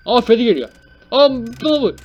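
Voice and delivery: high, loud startled outburst; real jolt of panic; alarmed cry. Real, not acted. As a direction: cry out as the threat appears. Player sees Freddy approaching.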